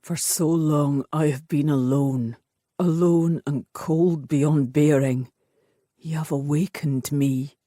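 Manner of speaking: brooding warrior thawing; lonely lover confessing. A narrator's reading. Bane's voice.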